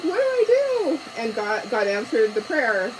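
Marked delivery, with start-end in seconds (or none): 0.0-1.0 exasperated. Retold or imitated. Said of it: "I'm like".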